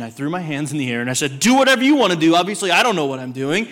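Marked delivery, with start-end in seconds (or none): 1.4-3.7 bad attitude. Husband sulking at his wife over the fire, louder exasperated outburst. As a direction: throw your hands up and snap it back. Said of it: "with the worst attitude you can imagine".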